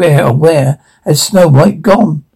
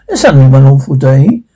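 ordinary delivery

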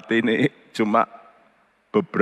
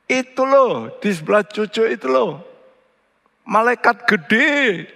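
excited aunt; 10 semitones higher than usual. Retold or imitated. Voicing the praying aunt.

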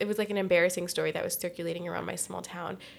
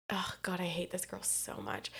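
whispered aside; 10 dB quieter than usual. Real, not acted. Hushed confession of hate.

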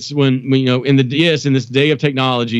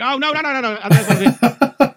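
high, laughing backpedal; nervous laughing denial; 11 semitones higher than usual. Retold or imitated. Son laughing off his mother's question, nervous high backpedal. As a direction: laugh it off, caught out. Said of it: "I'm like".

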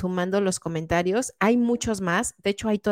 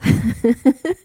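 laugh; 5 semitones higher, 6 dB louder than usual. Real, not acted. Host laughs at emoji joke.

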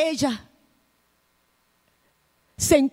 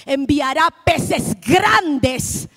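very slow, weighing each word; slow preacher's emphasis on peces grandes; slow emphatic delivery; 2 semitones lower, much slower than usual. Real, not acted. Preacher stressing key phrase.

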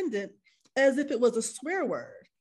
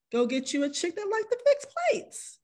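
mock high voice; 9 semitones higher than usual. Mocking a man's preferences.

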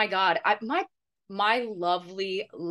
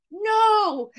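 high, loud drawn-out protest; retold yell; 13 semitones higher, 6 dB louder than usual. Friend retelling her interruption at the drive-thru, mock yell. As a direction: cry out to stop the order, re-enacted. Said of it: "I literally yelled at the lady".

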